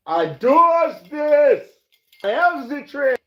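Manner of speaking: high, loud and drawn-out show-off voice; hammed-up high diva brag; mock diva voice. Bragging to viewers, in a high, loud put-on diva voice.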